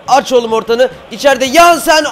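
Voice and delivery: loud, rising call for the cross; urgent shout. Real, not acted. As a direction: yell to your players mid-attack. Calls to players in attack.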